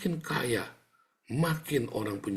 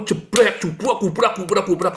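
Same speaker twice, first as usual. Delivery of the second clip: much louder and higher, banging out the crashes; loud crash sounds; 8 semitones higher, 9 dB louder than usual. Retold or imitated. Preacher shouts crash noises describing household fights, loud and high.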